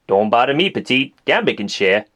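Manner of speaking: smooth low come-on; Gambit voice. Acted. Figures voiced in a skit.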